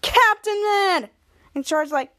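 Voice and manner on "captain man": high, drawn-out fan squeal of a greeting; thrilled, stretched-out exclamation; excited. Acted. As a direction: cry out the hero's name in excitement. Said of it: "was so excited when he said".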